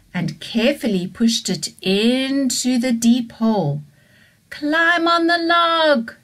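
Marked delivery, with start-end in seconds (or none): 4.5-6.2 higher, slow sing-song call down; shouted encouragement. Acted. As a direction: call it down into the hole, half singing. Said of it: "they shouted".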